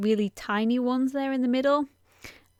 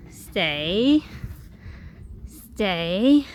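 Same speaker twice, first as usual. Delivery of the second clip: dog command; 3 dB louder than usual. Real, not acted.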